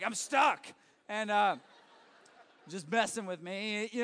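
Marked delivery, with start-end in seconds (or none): 0.0-0.7 loud, a mock call for help; half-playful yell of being stuck; retold yelling. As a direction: shout it like she's stuck. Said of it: "she's yelling".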